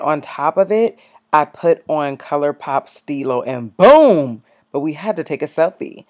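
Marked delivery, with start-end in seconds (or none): 3.8-4.4 loud, booming reveal; sudden big sound-effect boom; punchy exclamation. Pretend. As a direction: hit "boom" loud like a finishing flourish. Punctuates the finished lip look.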